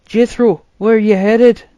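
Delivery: high, loud worried call to his brother; concerned call. A narrator's reading. Arthur calls anxiously after his brother. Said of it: "highly concerned for him".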